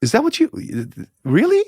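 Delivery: high, rising mock disbelief; retold disbelief. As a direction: squeak out his astonishment at the uncle's claim. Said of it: "I went".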